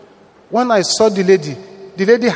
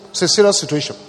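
ordinary delivery

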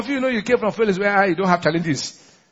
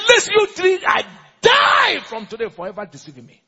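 high, loud shouted curse; angry curse; 7 semitones higher, 4 dB louder than usual. Acted. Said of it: "He said".

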